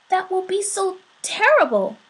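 a touch of nervousness; a little nervous. A narrator's reading. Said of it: "just a little nervously".

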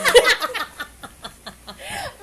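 real rising laughter; cracking up at the memory; high laugh. Real, not acted. Laughing at own story.

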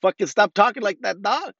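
high, animated mock objection; Mexican heckler reacting. Speaker as a Mexican answering the court's cry, mock exasperation. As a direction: pitch it up in playful exasperation. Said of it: "as a Mexican, I'm like".

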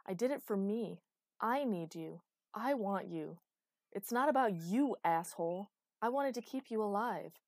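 loud, emphatic insistence; heated declaration of need; impassioned confession. Acted. Acted quarrel, tearful confession.

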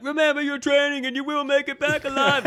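high, strained impression of a rallying officer; rally the troops in a high put-on voice; mock squad leader. Imitating the squad leader before the landing, high mock-heroic call. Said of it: "the guy's like".

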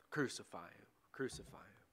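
very soft, low repetition; hushed echo. A narrator's reading. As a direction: let the chant fade away, almost under the breath. Shouts prevailed, echoed in a hush.

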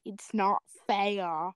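drawn out, slow and mournful; slow dramatic. Acted. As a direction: drag each word in mock grief. Dramatic repeated line.

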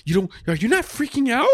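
high-pitched imitation of an alarmed question; retold panic. Husband imitating his freaked-out wife questioning him, mock panic.

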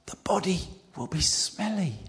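hushed, unvoiced whisper. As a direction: whisper it like a worried aside. Martha protesting at the tomb.

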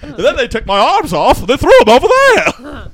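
loud, high, put-on scarecrow voice; hammed-up high scarecrow wail; Wizard of Oz scarecrow. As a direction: imitate the scarecrow in loud comic distress. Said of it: "That's the scarecrow in the Wizard of Oz".

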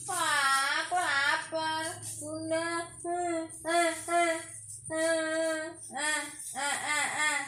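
put-on high whiny ah ah of a hungry toddler; hungry whining child. Mother acting a hungry child for her son. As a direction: whimper and point for food.

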